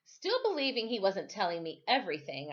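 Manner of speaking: plain reading voice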